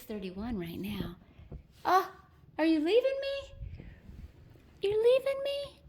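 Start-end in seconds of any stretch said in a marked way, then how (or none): 1.9-5.8 squeaky mock-wounded pet talk. Real, not acted. Cat walking away.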